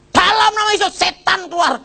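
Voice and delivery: very high mimicked command; hammed-up cry casting out a demon; exorcism cry. Church worker casts devil out of teller, shrill mimicked command.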